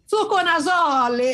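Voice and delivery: slow, drawn-out Italian intonation; mock Italian accent. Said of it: "o italiano tem que fazer assim".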